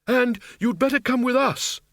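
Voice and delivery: high-pitched calling out; shouted. A narrator's reading. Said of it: "Windle shouted".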